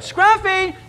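high call for the dog. Retold or imitated. Pastor calling the lost dog a second time. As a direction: call the dog's name up the street.

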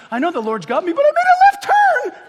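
squeaky high comic voice jumping up; mock excuse. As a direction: play the sheepish believer for a laugh.

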